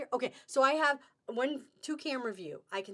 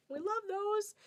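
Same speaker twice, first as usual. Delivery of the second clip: higher delighted squeal; 10 semitones higher than usual. Real, not acted. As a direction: lift it higher, gushing.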